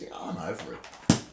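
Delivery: fed up, breathy sigh; exasperated. Real, not acted. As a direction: give up with a huff. Exasperated quit, unvoiced.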